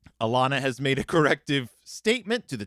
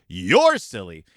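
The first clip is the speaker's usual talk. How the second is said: lower, louder retold yell; mock yell; 9 semitones lower, 6 dB louder, slower than usual. Brother yelling at his sister from the chair, mock shout. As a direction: throw it at her like a mild mock shout. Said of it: "yell at his sister".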